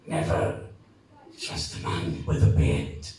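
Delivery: very low deep dying father's voice; dying dad's last words. Acted. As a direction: croak it out as the dying dad. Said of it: "he's trying to say something".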